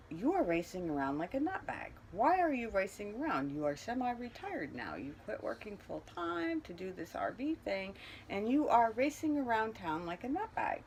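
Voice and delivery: high, exasperated self-scolding; scolding herself. Retold or imitated. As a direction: tell yourself off, incredulous. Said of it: "I realized".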